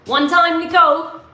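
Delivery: ordinary talk, nothing marked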